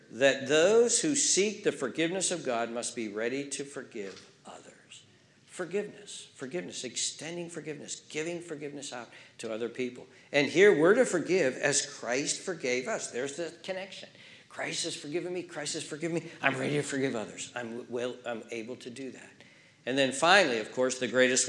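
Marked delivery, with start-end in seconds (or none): 1.6-10.1 whispered preaching; hushed whisper. Acted. Whispered sermon point. 13.1-19.3 quiet intimate preaching; hushed personal testimony; soft. Acted.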